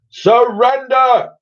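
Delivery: high, slow, loud hailer boom; loud hailer call to surrender; booming demand. Acted. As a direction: bellow it across the water. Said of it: "boomed a voice over the loud hailer".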